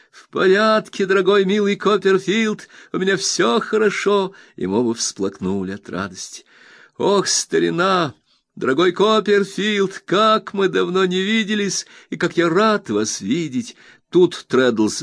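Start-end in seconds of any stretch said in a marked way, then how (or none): 0.1-4.3 high, bright-eyed friend overjoyed; eager Traddles. Acted. Traddles reassures his old friend Copperfield, eager high voice. 7.0-13.8 high, bright-eyed friend overjoyed; eager Traddles. Acted. Traddles reassures his old friend Copperfield, eager high voice.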